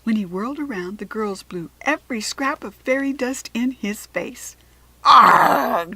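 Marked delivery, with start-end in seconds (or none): 5.0-5.9 furious loud arrgh; loud roar. A narrator's reading. Jack Frost roars as fairy dust hits his face. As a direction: bellow in shock and rage. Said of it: "Jack Frost roared".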